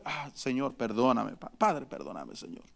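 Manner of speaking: low, hushed, sighing plea; imitating a believer murmuring sorry after yelling at his wife; mock contrite prayer. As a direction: breathe it like a quick sorry prayer. Believer quickly asks forgiveness.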